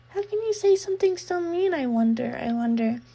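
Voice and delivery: put-on eerie pouting girl voice; sweet hurt girl. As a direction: tease with wounded sweetness. Reina hurt sing-song.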